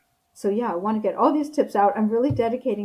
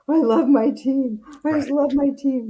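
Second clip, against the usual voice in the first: mock champion speech; 5 semitones higher, 7 dB louder than usual. Champions at the microphone thanking their team, loud excited declaration. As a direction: proclaim your love for the team.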